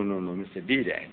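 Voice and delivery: usual speaking voice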